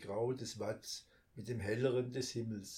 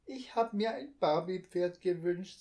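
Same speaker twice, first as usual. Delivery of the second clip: put-on higher, chirpy child voice; little girl's voice; 7 semitones higher, 5 dB louder than usual. A narrator's reading. Little girl chatters to her brother about her wish. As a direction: voice the little sister brightly.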